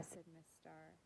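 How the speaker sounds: plain reading voice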